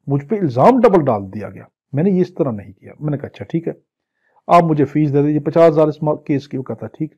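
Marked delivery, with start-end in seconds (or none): none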